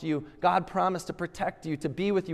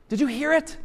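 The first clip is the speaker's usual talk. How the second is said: excited; 8 semitones higher, 3 dB louder, faster than usual. Acted. Preacher calling out to congregation.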